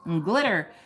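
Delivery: lightly voiced gushing flatterer; a hint of a syrupy cheerleader; sugary fake praise. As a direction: coo it like empty sweet praise. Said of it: "It's not gonna be sugar-coated".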